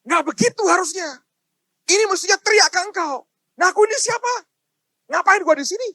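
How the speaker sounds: loud, high pleading protest; retold inner scream. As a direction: cry out to God in frustration, acted out. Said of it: "saya jerit dengan Tuhan dalam hati". (menirukan jeritan protes kepada Tuhan)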